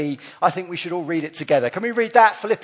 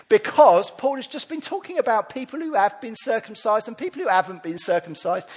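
usual speaking voice

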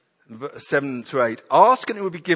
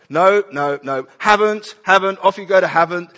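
louder, half-joking exasperated protest; mock exasperated; 3 semitones higher, 6 dB louder than usual. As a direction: groan at the next task in jest. Acting leaders' exasperated response.

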